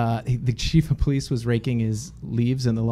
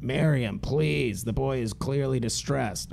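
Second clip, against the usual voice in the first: a hint of a soft-spoken older man; quietly calm the wife down; police chief soothing his wife, softly voiced; calm husband; 7 dB quieter, slower than usual. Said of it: "and the guy's like".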